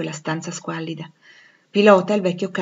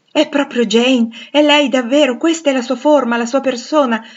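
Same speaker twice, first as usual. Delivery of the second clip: high, loud, impassioned exclamations; overjoyed recognition; 7 semitones higher, 9 dB louder than usual. Acted. Rochester holding Jane and recognising her form, overjoyed. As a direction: exult as each touch confirms it. Blind man recognises his lost beloved.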